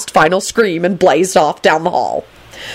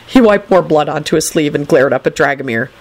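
usual speaking voice

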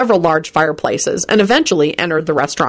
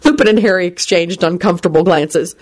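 usual speaking voice

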